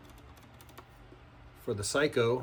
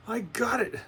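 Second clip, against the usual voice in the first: excited; 10 semitones higher than usual. Real, not acted. Finally threading the wire through.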